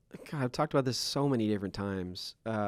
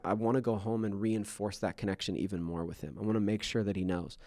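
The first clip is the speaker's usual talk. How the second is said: ordinary talk, nothing marked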